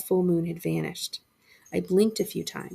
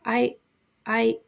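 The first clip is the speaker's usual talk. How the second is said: higher, scared stammer; 5 semitones higher, 4 dB louder than usual. A narrator's reading. Boy stammers at faceless shadow kids, afraid. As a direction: stumble on the word in fright. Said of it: "I stammered".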